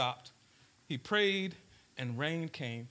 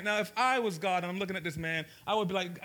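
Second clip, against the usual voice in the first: usual speaking voice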